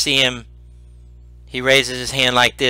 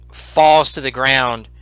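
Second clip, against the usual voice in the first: usual speaking voice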